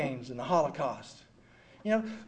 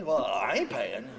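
lower, slow, flat-out refusal; stubborn; 8 semitones lower, slower than usual. Retold or imitated. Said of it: "Oh, I was furious".

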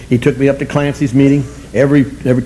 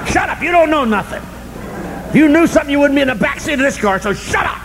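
high-pitched mock yell; imitated blunt sponsor; 12 semitones higher, 4 dB quieter than usual. Sponsor to newcomer in the car, mock-yelled scolding. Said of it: "But he knew how to put it".

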